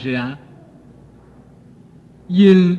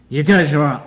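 ordinary talk, nothing marked

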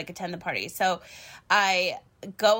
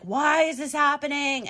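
imitating her stressed self, voice raised high; mock-distressed wail, pitched up; stressed outburst; 7 semitones higher than usual. Stressed driver retelling the tire trouble before a job, higher-pitched mock distress. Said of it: "I was so stressed out".

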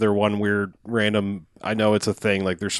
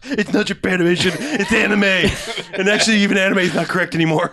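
high, fussy know-it-all correction; mock pedantic; 9 semitones higher than usual. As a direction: correct them like an anime snob.

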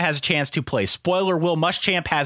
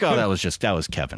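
very low, deflated mock reply; unenthused; 11 semitones lower, faster than usual. Co-host imitating his friend's letdown reply, unenthused. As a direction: drop the pitch right down, letting all the excitement out. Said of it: "you were so unenthused".

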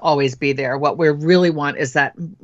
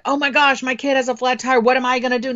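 high, anxious rush of a worried parent; mock panic over the kid's flat tire; panicky parent; 7 semitones higher than usual. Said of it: "energetic need to know".

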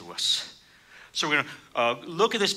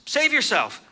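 mocking; 11 semitones higher, 4 dB louder than usual. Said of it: "mocked him".

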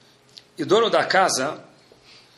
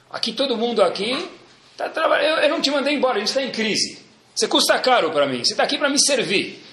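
mock angry boss; 7 semitones higher than usual. Boss voiced yelling at employee.

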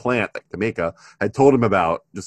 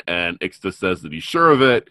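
normal talking voice

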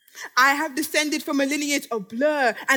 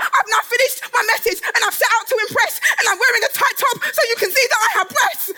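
loud, higher, laughing declaration; laughing shout; 7 semitones higher, 4 dB louder than usual. Acted. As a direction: shout the finale through laughter. Grand finale of the pitch.

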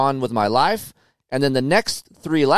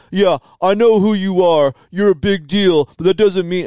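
higher, louder voice of the other rider; heated rider; 5 semitones higher, 5 dB louder than usual. Retold or imitated. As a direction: snipe back at him.

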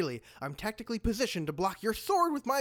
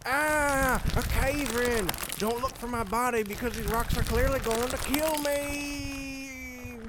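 hammed-up dying scream; 5 semitones higher, slower than usual. Villain buried in a cave-in, wailing. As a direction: cry out theatrically as rocks fall.

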